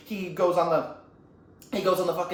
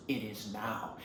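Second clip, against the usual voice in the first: low, soft reply; quiet menace; 9 semitones lower, 11 dB quieter, slower than usual. Acted. Villain answers his master as he takes it, quietly menacing. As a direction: drop it low and hushed. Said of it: "he goes".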